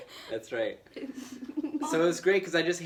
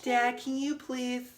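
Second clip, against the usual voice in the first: higher, slow whiny plea; pleading kid voice; 11 semitones higher, much slower than usual. Retold or imitated. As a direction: drag out a whiny kid request. Voicing daughter who didn't ask.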